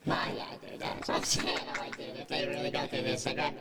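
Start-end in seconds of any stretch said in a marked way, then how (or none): none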